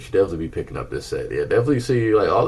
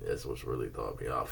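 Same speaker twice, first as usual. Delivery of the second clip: sleepy yawning voice; 6 semitones lower, 12 dB quieter than usual. Real, not acted. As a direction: talk through a yawn. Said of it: "I hate when I yawn".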